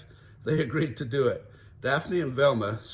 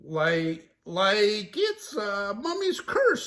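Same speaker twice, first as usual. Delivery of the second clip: high, slow goofy Shaggy; spooked teen; 8 semitones higher, 3 dB louder, much slower than usual. Acted. Shaggy voiced.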